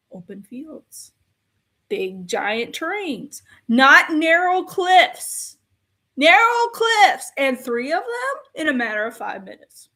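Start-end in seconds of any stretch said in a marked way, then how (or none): 3.7-7.3 slow, high, loud stress on narrow cliffs; outraged, drawn-out emphasis on narrow; exasperated emphasis. Real, not acted. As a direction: hammer narrow cliffs in disbelief. Ranting about AT-ATs on cliffs.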